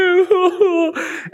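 high sobbing wail; crying wail. Retold or imitated. Lost little boy on the beach, sobbing high. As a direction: let out a lost child's sob.

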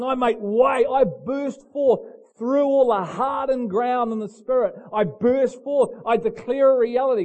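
loud, high, ringing proclamation; full-force preaching. Real, not acted. Preacher voices John declaring one is coming, preaching at full force. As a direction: proclaim it like a herald making way.

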